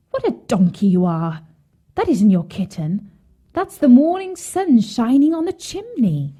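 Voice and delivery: mildly scolding, lifting high at the end; a touch of exasperated correction; exclaiming stepmother. A narrator's reading. Woman corrects Hansel about his kitten, mildly exclaiming. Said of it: "The woman exclaimed".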